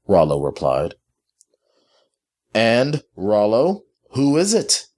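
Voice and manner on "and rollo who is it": higher-pitched, irritable questioning. A narrator's reading. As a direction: press the servant with mounting irritation. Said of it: "asked in an irritable tone".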